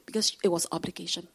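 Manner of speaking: usual speaking voice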